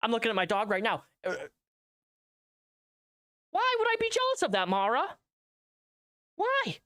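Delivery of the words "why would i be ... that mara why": high, fast, incredulous squeak; mocking incredulity. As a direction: scoff in disbelief, pitched way up. Mocking him to the dog.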